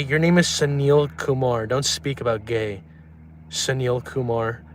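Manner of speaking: slow, drawn-out mocking of a name; slow teasing. Streamer slowly taunting a chatter by name. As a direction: spell it out slowly to taunt him.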